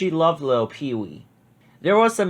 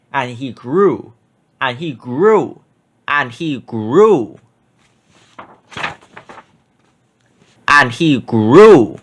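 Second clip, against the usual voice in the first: slow, getting louder each time to a big finish; growing bigger and louder, slowly; building growth; 6 dB louder, slower than usual. A narrator's reading. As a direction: build from steady to booming.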